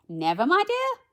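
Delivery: high, loud storybook fairy voice; mysterious voice. A narrator's reading. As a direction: chime in high, lilting upward. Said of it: "said a voice".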